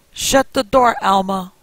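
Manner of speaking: loud, high shout from another room; yelled from deep inside. A narrator's reading. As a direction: bellow it across the house. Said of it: "a man's, yelled from deeper in the house".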